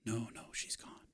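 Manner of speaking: soft unvoiced whisper. The two women whispering at the door about the missing girl. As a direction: hush it right down. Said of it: "they keep whispering like this".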